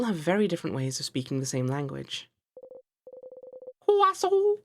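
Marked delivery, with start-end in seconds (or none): 3.9-4.6 high, loud squeal of delight; excited shout. Real, not acted. Player cheering for the croissant, high and loud. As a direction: blurt it out, thrilled.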